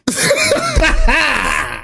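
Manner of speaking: high laugh falling away; incredulous laugh. Real, not acted. Streamer cracking up at the statement, incredulous laugh. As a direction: burst out laughing, then let it sink.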